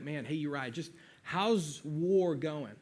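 super casual. Retold or imitated. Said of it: "he's super casual about it".

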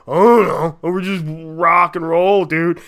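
slow, loud stoner dude drawl; laid-back rocker voice. Retold or imitated. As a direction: play a clueless rock-and-roll dude. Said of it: "bands just being like".

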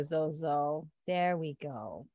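soft, low murmur to a dog; slow, hushed reassurance; soothing. Real, not acted. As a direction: settle the dog down gently and quietly. Settling the dog down.

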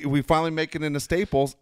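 ordinary delivery

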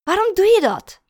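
loud, high reproach; exasperated shouted question; appalled outburst. A narrator's reading. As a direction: confront her in disgust. Disgusted boyfriend confronts her.

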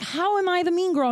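high-pitched, incredulous comeback; retold indignant protest. Disputing being called the mean girl, indignant. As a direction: protest the accusation, pitched well up. Retold bar argument with partner.

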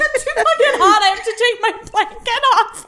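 high, loud helpless giggling; can barely talk for laughing; laughing hard. Real, not acted. Hosts cracking up mid-recording, laughing hard.

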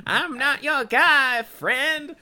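high, loud cartoon comeback; slow, hammed-up South Park parody; Terrence and Phillip. Host playing along with cohost's joke, high loud cartoon voice. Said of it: "sound like Terrence and Phillip".

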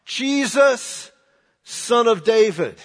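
high loud desperate cry from the roadside. Acted. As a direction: call out to him with everything. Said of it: "he begins to cry out".

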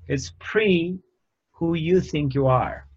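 usual speaking voice

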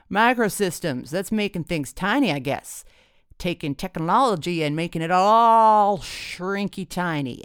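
loud tipsy mocking dad; tipsy, shameless. A narrator's reading. Tipsy dad belittles the boyfriend's major, shameless. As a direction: blare it out with a shameless swoop. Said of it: "feeling no pain and no shame".

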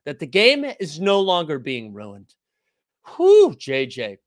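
slow, loud mock sigh of relief; sarcastic. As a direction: fake relief for JJ's sake. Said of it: "you were being sarcastic".

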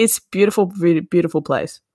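ordinary talk

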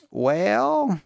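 high, stretched Southern "Well"; butchered Tennessee accent. Retold or imitated. Woman answering his polite refusal, in a butchered Tennessee accent. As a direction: imitate a Tennessee drawl, overdone. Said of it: "butchering your, your accent".

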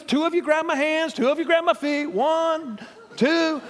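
high, lightly voiced Jonah directing the sailors; counting off for his own toss; Jonah giving orders. Jonah telling sailors how to throw him, lightly voiced. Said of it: "He's laying there with his hands like this".

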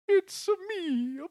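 high, hammed-up Italian Mario voice; over-the-top Italian Mario impression; mock Italian. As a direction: camp Italian cartoon accent, drawn out slow. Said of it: "literally a Mario character right now".

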